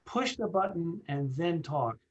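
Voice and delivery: each word spaced and weighted; deliberate, measured emphasis on a safety rule; slow emphatic instruction. Shown as a demonstration. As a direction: drive the rule home slowly. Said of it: "I cannot overemphasize".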